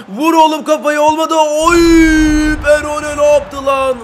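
high, loud shout as the chance goes; excited yell. Real, not acted. Gamer shouting at his striker to head it, loud. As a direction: yell at the header, then cry out. Header chance missed in game.

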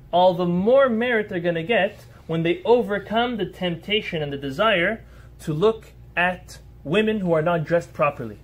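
usual speaking voice

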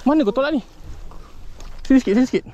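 high-pitched, anxious-to-land-it call; urgent protest. Real, not acted. Angler fighting a fish pulling away, urgent. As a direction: cry it out high and pressing.

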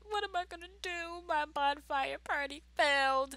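high, fast whiny panic; mock despair. [name] panicking over her failed party, high whiny despair. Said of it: "I was like".

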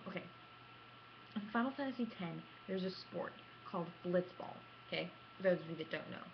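ordinary talk

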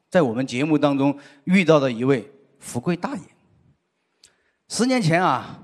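ordinary talk, nothing marked

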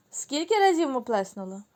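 ordinary talk

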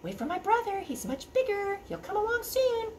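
high soft sly goat voice; little goat. Acted. Little goat tricking the troll, high and sly. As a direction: coax him to wait. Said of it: "the little goat said".

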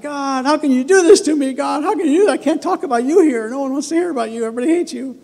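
high, loud whiny plea to God; hammed-up protest; mock complaint to God.

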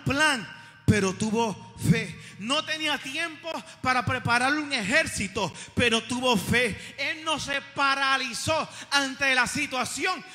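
loud, slow, emphatic refrain; impassioned preaching. Real, not acted. Preacher praising a king who had faith, loud and impassioned. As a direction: drive each phrase home at full volume.

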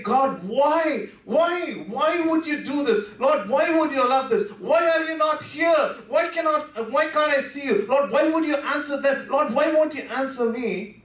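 high, pleading cries of why, rising to a shout; anguished cry to God. Retold or imitated. Preacher voicing his own despair to God, pleading high cries. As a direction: cry out to God from the lowest point. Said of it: "And then I turn around and I say".

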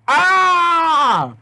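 high piercing scream; a full scared shriek; loud terrified scream. Acted. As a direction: scream in terror at the logo. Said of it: "I screamed loudly".

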